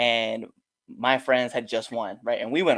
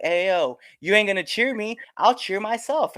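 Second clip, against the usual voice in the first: raised-pitch mild goading; heel taunt; 7 semitones higher than usual. Retold or imitated. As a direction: taunt the fan, pitch climbing. Wrestler as heel taunting fan.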